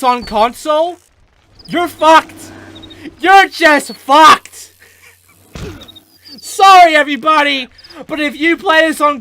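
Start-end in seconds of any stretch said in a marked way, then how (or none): 1.7-4.7 2 loud, slow, fed-up yell; 3 spit it out at console players, loud; 4 yelling a warning to console players; 1 exasperated yelling. Real, not acted. 6.4-7.7 1 loud mock apology. Real, not acted. Mock apology mid rant.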